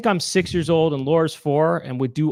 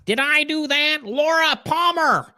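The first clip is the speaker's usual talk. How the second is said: very high, squeezed nerd voice; Steve Urkel; slower than usual. Acted. Doing the director as a sitcom nerd, very high put-on voice. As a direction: push it way up high and hold it there. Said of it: "just do an impression of Steve Urkel".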